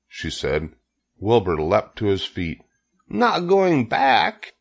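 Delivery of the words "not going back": high, drawn-out disbelieving question; alarmed cry. A narrator's reading. Wilbur hears Charlotte won't return, alarmed cry. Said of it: "he cried".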